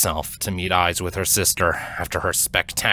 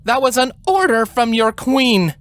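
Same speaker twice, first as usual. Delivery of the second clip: higher, firm royal order; commanding queen; 3 dB louder than usual. A narrator's reading. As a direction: pull rank on your little sister.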